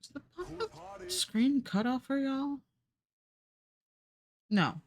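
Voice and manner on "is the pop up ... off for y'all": high silly voice. Acted. Asks chat in odd voice.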